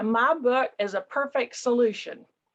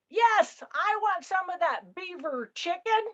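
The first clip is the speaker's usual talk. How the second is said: a burly passenger booms down the aisle; yelled order; 6 semitones higher than usual. Retold or imitated.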